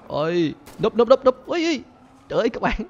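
very high, loud "núp" cries; panicked yelps. Real, not acted. Dodging heavy fire, high panicked yelps. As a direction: scramble for cover.

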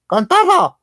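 high, loud street-vendor call; vendor's yell. Retold or imitated. Vendor shouting to customer, high call. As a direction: belt it out like the tlacoyo lady. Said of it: "y me decía".

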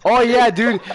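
ordinary talk

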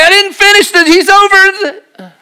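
hammed-up gardener, very high. Gardener voiced, high put-on.

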